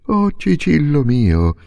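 joyful exclamation. A narrator's reading. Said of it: "esclamò il padre".

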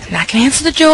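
ordinary talk, nothing marked

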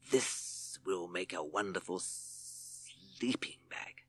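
slow, soft whispered hiss; Hissing Sid whispering over his find; hissed to himself. Acted. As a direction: gloat privately like a sneaky snake. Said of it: "hissed Sid to himself".

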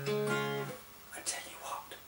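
put-on breathy whisper to the kids. Shown as a demonstration. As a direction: whisper it secretly. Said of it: "your whispering voice".

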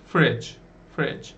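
Fridge as frij, short e sound; teacher modelling fridge; careful fridge. Shown as a demonstration. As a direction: model Fridge slowly for learners. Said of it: "Bunun okunuşuna dikkat edin".

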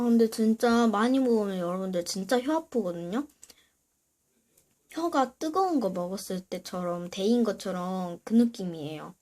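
ordinary delivery

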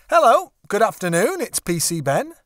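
brisk, bright phone greeting in a lightly voiced Ben; cheery policeman. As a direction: answer the phone like a friendly officer on duty. Ben answers his phone.

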